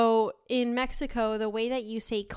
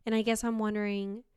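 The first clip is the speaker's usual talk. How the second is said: ordinary delivery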